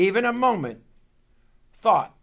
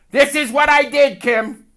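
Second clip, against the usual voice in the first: higher, louder boastful brother; brother's voice; 11 semitones higher, 13 dB louder than usual. Retold or imitated. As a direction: announce it proudly as the brother. Brother's proud phone call.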